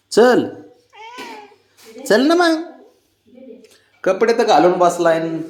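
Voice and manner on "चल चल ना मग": high, pleading call to the pet; high calling to a lazy pet; high coaxing call. Real, not acted. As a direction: coax him up in a high voice.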